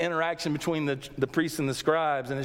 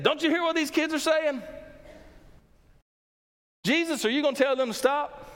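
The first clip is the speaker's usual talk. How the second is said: high, pressing challenge of the indignant priests; mock-indignant scribes asking Jesus to stop the children; 10 semitones higher than usual. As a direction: demand it, high and affronted. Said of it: "They said".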